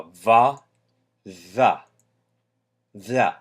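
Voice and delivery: va with lips slightly pursed; soft V, tongue up. Shown as a demonstration. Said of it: "I might purse my lips".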